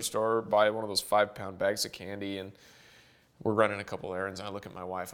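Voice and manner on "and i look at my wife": ordinary talk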